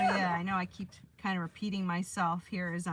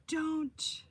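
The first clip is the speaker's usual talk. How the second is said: pleading; 3 semitones higher, much slower than usual. Real, not acted. Pleading with dying stove fuel.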